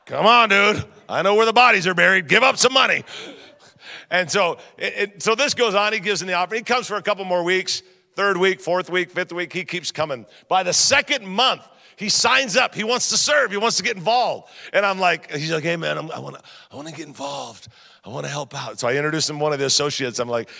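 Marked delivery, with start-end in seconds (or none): 0.0-3.0 loud, hammed-up pleading; mock exasperated urging. 15.6-18.8 low, soft-spoken tough-guy imitation; Glenn. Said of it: "this is exactly how he talks".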